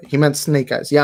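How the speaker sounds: usual speaking voice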